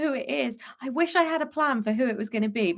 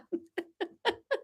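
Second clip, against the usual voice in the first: breaking into a high giggle; can't keep a straight face; real giggle; 9 semitones higher, 9 dB quieter than usual. Real, not acted. Laughs at own plot twist.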